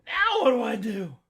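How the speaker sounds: put-on loud, high, panicky young man; panicked Jack. Jack asking the sheriff's daughter, panicked and loud. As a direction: blurt it out in a panic. Jack's panicked question in story.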